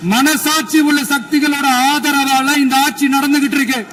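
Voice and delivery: high-pitched, impassioned rally-style speech; fiery oratory. Acted. Chief Minister hitting back at critics in the assembly, fiery oratory. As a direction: hammer each line home to the crowd.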